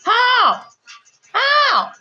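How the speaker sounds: high mocking how; incredulous. Real, not acted. Incredulous at failed spell.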